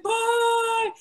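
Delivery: high, mock-tragic goodbye; melodramatic farewell. Comics parting like the Titanic's final scene, mock tragic. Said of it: "final scene from the Titanic".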